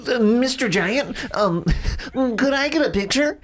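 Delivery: high, small nervous voice; shyly asking a giant for a picture; timid fan. Retold or imitated. Fan timidly asking Andre for a photo, high and small. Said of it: "a fan coming up to a man and be like".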